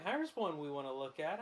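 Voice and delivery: ordinary talk, nothing marked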